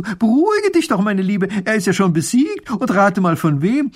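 high, loud call to the fleeing neighbour; calling after her. A narrator's reading. Mathilde calls after her frightened neighbour, loudly. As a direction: call her back, eager to tell. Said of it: "Mathilde ruft ihr zu".

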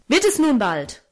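mildly angry impatient call; understated paternal wrath; angry father. A narrator's reading. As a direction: demand it crossly. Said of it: "rief er zornig".

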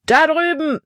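high-pitched call across a distance; shout. A narrator's reading. Man alerts the other slave catchers, shouting high. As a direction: cry out the sighting. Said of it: "schrie ein Mann".

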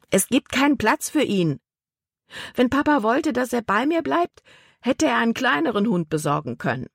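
put-on heated girl's comeback; indignant girl. A narrator's reading. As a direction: spin round and snap back at the boy. Libby whirls round angrily.

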